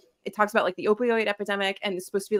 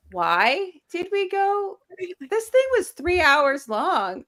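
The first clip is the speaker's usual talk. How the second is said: high, slow mock disbelief; drawn-out incredulous quote, pitched way up; baffled hindsight; 9 semitones higher, slower than usual. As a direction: act out exasperated hindsight. Acting out everyone's baffled hindsight.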